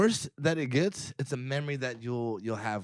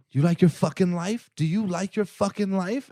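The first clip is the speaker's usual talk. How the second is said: loud, high mock threat, slowing on the repeat; mad; 7 semitones higher, 8 dB louder than usual. Small angry woman confronting him in a bar, mad. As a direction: imitate an angry challenge, hitting it hard. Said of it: "she's getting all mad".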